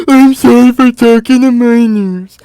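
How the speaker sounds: mock apology. Mocking Ethan's apology.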